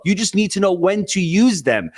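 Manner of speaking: ordinary talk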